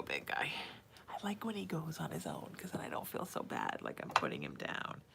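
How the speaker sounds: soft whispered aside. Real, not acted. Whispering while baby settles.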